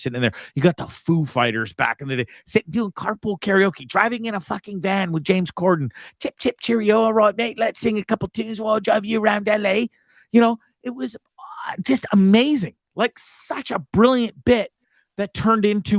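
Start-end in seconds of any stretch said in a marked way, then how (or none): 6.2-9.9 a mock cheery British accent, pitched higher; a hammed-up British chap offering a lift. Mocking Corden's British accent.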